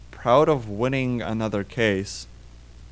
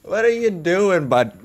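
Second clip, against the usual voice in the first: laughing; 6 semitones higher than usual. Real, not acted. Laughing at the weird walk.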